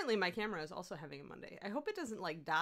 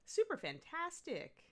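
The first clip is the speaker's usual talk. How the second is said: cheery thanks; 10 semitones higher, slower than usual. Real, not acted.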